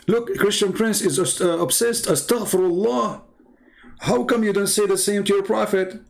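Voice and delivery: high, lightly put-on mock of the caller. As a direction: mimic her scolding him, pitched high. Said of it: "Let me show you what this lady, she just said".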